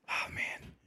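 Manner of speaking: breathy hushed whisper, barely voiced; mock whisper. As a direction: whisper like hiding from the Gestapo. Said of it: "my whisper is a normal speaking voice".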